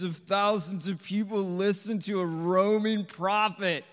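loud, high preaching peak; preaching at full force. Acted. Preacher rousing the congregation about Nineveh, loud and high. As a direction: build it up to a loud, high climax.

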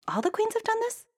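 very high astonished question; surprised princess. Acted. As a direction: ask amazed. Princess voiced in story.

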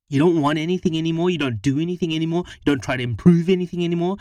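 loud, high tirade, speeding up midway; wife ranting at her partner, loud and furious; angry rant. A narrator's reading. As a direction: unload everything on him at once. Harriet's angry rant at Charlie.

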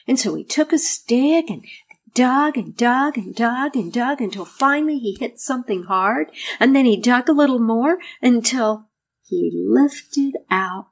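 gentle storyteller reading a fairy tale; story narration. A narrator's reading. Narration continues after quote.

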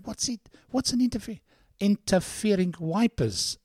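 high, baffled query; puzzled question. Retold or imitated. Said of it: "I'm going".

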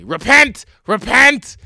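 high, loud fire-and-brimstone cry; loud mock street preacher yelling Repent; preacher shout. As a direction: bellow it like a street preacher warning sinners. Neighbor preaching at him.

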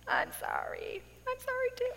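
soft, squeaky imitation of crying; mock weepy voice. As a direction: replay the tearful apologies small and soft. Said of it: "I'm crying".

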